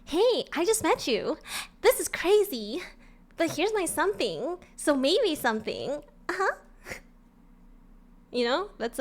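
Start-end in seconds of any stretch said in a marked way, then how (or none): 0.0-6.5 put-on high, innocent girl-next-door voice; cutesy pop-star voice. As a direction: recite it like a sweet ingénue, pitched up. Speaks lyrics in cutesy character.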